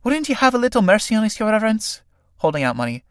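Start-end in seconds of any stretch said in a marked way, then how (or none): 0.0-2.0 pleading. A narrator's reading. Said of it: "Sarah, pleadingly".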